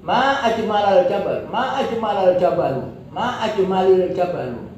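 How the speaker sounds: each MA high, then falling through "al jabal", "al jabalu"; exclamation of wonder. Shown as a demonstration. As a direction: exclaim each "Ma ajmal" in the same high-to-falling tune, whatever the ending. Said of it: "Intonasinya yang tidak bisa".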